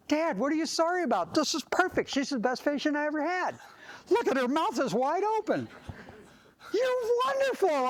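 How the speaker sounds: high-pitched, delighted reassurance to a parent; gushing praise. Acted. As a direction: gush brightly over the crying child, rising to a high peak. Dentist cheerfully praises screaming child to parent.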